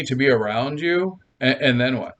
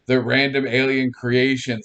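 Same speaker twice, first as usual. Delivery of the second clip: ordinary talk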